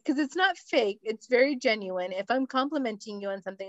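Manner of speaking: ordinary delivery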